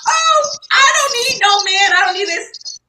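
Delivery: high mock shout of a proud woman; hammed-up sassy imitation. Mocking women who claim independence.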